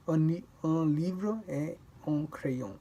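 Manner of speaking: slow un livre et un crayon, no T before un; no T after et. Shown as a demonstration. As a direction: keep et and un separate. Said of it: "You don't pronounce the T".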